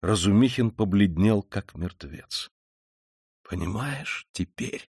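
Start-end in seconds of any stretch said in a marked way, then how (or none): 3.5-4.9 soft, slow, weighted question; pained hushed question. A narrator's reading. Raskolnikov to his horrified friend, hushed and pained. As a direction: drop to a slow, pained near-whisper. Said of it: "сказал вдруг Раскольников с болезненно искривившимся лицом".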